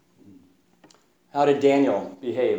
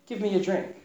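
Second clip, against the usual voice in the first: gentle; 6 semitones higher, 7 dB quieter, faster than usual. Retold or imitated. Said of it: "in a very gentle kind of way".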